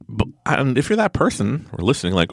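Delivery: ordinary delivery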